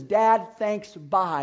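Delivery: a loud, taunting goodbye to Dad; mock farewell. The son flaunting cash at his father, loud taunting. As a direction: toss it at him and leave.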